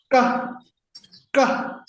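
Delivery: ka said high and crisp; syllable demo. Shown as a demonstration. Teacher modelling the syllable for students to repeat. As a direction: give each ka a clear high pitch. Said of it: "say it with the same time".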